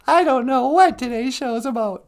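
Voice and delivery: put-on a squeaky child, a bit clueless; high kid voice. Child answering the host in a high voice. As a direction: play the small child co-host.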